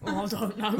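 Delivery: ordinary talk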